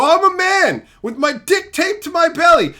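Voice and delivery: puffed-up henpecked man; mock defiant husband. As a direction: stand up to the wife, half-seriously. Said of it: "And go".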